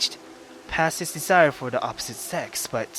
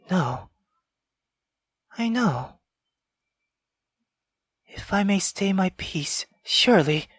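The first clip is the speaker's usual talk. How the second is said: put-on higher, partly whispered girl's voice; eerie young girl whispering to her mother; girl talking to her mother; 6 semitones higher than usual. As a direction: answer an unseen mother in hushed pleading. Girl talks to unseen mother.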